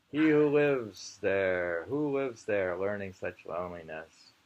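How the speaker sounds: slow, drawn-out poem reading with long weight on each word; very slow poetry reading. Shown as a demonstration. Reading a Japanese poem aloud to the camera, deliberately slow. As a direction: read it as slowly as possible, letting each line hang. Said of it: "We're reading it slow as possible".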